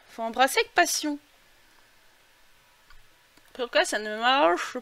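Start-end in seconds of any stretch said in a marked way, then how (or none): none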